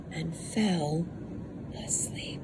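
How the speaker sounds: fading from soft to a whisper. A narrator's reading. Reading a bedtime story, fading into a sleepy whisper. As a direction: let the words drift off to sleep.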